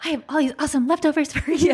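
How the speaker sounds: playfully overbright announcement; cheery offer. Pretend. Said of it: "last night I'm like".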